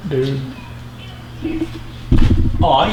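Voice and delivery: ordinary delivery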